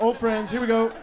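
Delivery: ordinary talk, nothing marked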